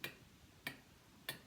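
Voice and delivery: short crisp k, /k/ with no added vowel; phonics k sound. Shown as a demonstration. Teacher giving the class a turn at saying k. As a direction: say the pure k sound again for the children's turn. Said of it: "Your turn".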